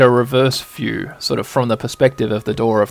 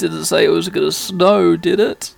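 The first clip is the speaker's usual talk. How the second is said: sneeze, then complain; sneezing nerdy boy; 4 semitones higher, 4 dB louder than usual. Acted. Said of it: "And he says".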